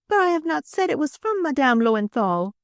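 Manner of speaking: high-pitched, pleading French madame; protesting Frenchwoman. A narrator's reading. Accused madame answering her questioner, protesting.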